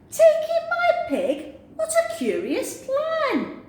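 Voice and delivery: very high, astonished; old woman, astonished. Acted. Old woman astonished at the wise man's advice, very high. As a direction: squeal the question in disbelief.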